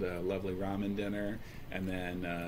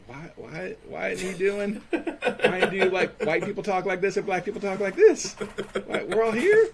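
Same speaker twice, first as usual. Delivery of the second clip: pitched way up and louder, a hammed-up caricature; 10 semitones higher, 7 dB louder than usual. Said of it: "they were just waiting, like".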